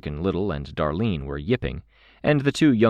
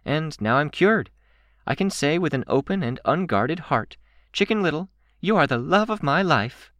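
high, bright mock-heartfelt declaration; 11 semitones higher than usual. A narrator's reading. Candy declaring love to a puppy, bright. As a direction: declare it brightly, pitched well up. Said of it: "her tone bright".

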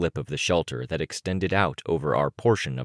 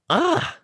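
very high-pitched exasperated groan; exasperated ugh; 4 dB louder than usual. A narrator's reading. Stung by being told it's not very good, high groan.

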